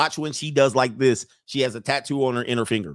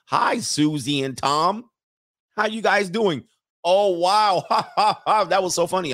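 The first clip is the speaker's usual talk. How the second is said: mock over-friendly greeting, pitched up; hammed-up social cheer with forced ha-ha; plugged-in couple greeting; 5 semitones higher than usual. Married people greeting another couple, fake plugged-in cheer. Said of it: "they all plugged in".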